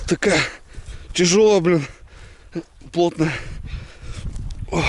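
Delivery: strained under a heavy log; effort grunt. Real, not acted. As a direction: push words out while hauling.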